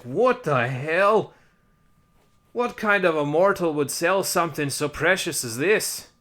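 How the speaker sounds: mock indignant Fae in a put-on Irish accent. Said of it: "I don't even know if that's an Irish accent".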